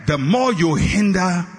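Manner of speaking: high, half-sung sermon cadence; preaching over music. Acted. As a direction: lift it into a chanting preach.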